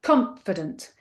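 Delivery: "confident" with the first syllable CON stressed; syllable stress demo. Shown as a demonstration. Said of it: "syllable stress in a word".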